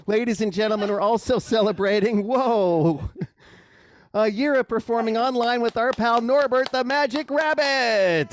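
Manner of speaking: high, loud showman announcement; kids party magician. Acted.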